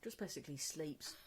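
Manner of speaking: breathy half-whisper about the son; hushed gossip about the teenager; whispered aside. Retold or imitated. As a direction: drop to a whisper for the joke. Mocking teen son sleeping.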